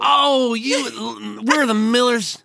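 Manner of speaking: high, sugary gushing fan; mock syrupy fan voice. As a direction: drip with sweetness, way up high. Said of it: "just syrupily".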